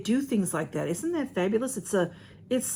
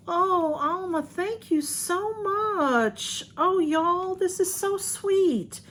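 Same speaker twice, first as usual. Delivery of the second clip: much higher, slow, gushing thanks; deeply touched; 10 semitones higher, much slower than usual. Real, not acted. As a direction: overcome by a sweet gift, voice lifting. Moved by a friend's gift.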